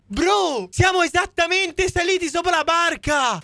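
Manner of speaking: high-pitched excited outburst; astonished exclamation. Real, not acted. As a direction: cry it out in high-pitched amazement. Astonished at landing on the boat. (esclamazione acuta e stupita)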